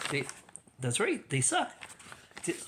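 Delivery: usual speaking voice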